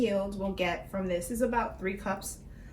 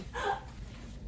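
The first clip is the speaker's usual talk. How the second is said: teary gasp; 13 semitones higher, 10 dB quieter than usual. Real, not acted. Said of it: "I'm literally crying right now".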